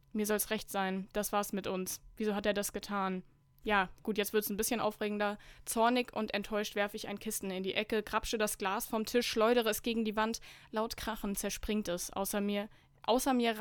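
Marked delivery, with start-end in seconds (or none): none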